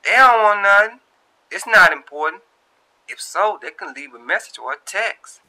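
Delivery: high, loud brushing-off voice; Shantae to herself. A narrator's reading. Shantae brushing off the missed calls, loud and high. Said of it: "she said to herself".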